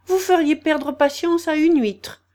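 high, loud peevish complaint; young crab exasperated with father; sour. A narrator's reading. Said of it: "d'un ton aigre".

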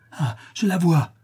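high exclamation through gritted teeth; through clenched teeth. A narrator's reading. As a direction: force out the sighting, teeth clenched. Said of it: "les dents serrées".

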